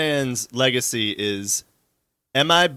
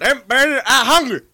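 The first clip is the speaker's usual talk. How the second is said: high, loud mock yell; hammed-up hungry holler; hollering at the dog; 12 semitones higher, 7 dB louder than usual. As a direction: yell like a hungry owner after his dog. Joining in as the dog's owner.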